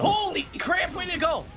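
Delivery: startled high yell; surprised shout. Real, not acted. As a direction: blurt it out in shock. Shouts at vanished pool.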